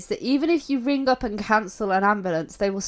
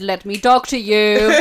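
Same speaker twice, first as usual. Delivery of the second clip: louder, slow, laughing imitation; amused mock quote; 2 semitones lower, 8 dB louder, slower than usual. Co-host mocking the fake call's wording, laughing. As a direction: relish the silly line while laughing.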